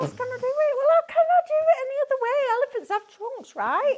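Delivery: high, loud, fast indignant protest. Real, not acted. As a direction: defend the drawing, outraged and high. Defending her elephant drawing.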